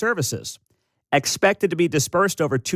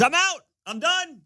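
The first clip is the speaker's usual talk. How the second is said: hammed-up indignant protest, pitched way up; mock outrage; 11 semitones higher than usual. Host mocking a fed-up DOGE supporter, mock outrage. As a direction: play a supporter dramatically quitting in high-pitched fury.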